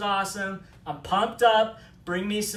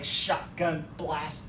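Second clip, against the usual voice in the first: normal talking voice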